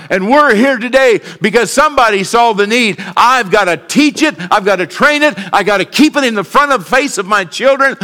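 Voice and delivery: rising preacher cadence; preaching. Acted. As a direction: preach it, pushing the pitch up. Builds sermon to raised pitch.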